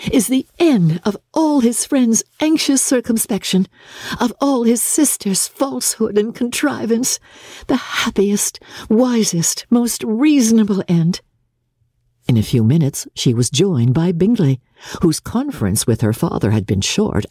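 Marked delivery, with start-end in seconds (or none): 0.1-11.2 put-on higher young woman's voice; a lightly ironic sister; wry private reflection; Elizabeth musing. Elizabeth muses alone.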